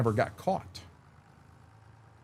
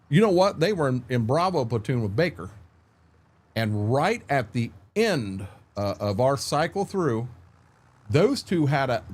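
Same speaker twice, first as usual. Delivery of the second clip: normal talking voice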